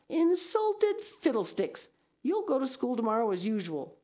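put-on high, sharp, scolding older woman; stern Marilla. A narrator's reading. Marilla dismisses Anne's complaint and orders her to school. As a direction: snap the order, brook no argument.

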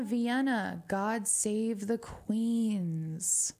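ordinary talk, nothing marked

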